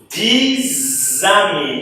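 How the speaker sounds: "dis ami" said slowly, the s turned to z; teacher drilling the liaison slowly; slow liaison demo. Shown as a demonstration. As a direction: stretch out "dis ami" with a clear z link. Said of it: "S vira Z".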